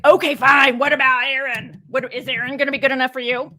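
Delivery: mock-annoyed God, loud and high; huffy, fed-up paraphrase of an irritated God; exasperated God. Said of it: "God got angry, and then he's like".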